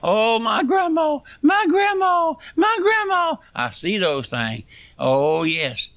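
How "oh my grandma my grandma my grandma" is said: much higher, louder mock wail of grief; mock boo-hoo wailing. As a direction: ham up a mourner's wail for grandma. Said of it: "waller around and cry and boo-hoo".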